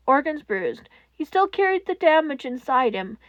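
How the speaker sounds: ordinary delivery, nothing marked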